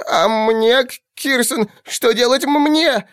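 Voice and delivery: very high, slow, stammering little creature; whimpering goblin. A narrator's reading. The little goblin, scared, begs the captain what he should do. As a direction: stutter it out, teeth chattering with fear. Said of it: "простонал маленький урк".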